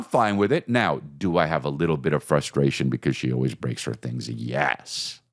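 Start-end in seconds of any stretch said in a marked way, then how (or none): none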